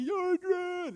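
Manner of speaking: high drawn-out mock yell; Rocky. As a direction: call out the name in a long, high movie-hero cry. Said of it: "You guys know Rocky?".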